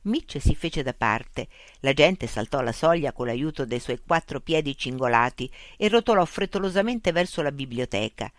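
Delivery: usual speaking voice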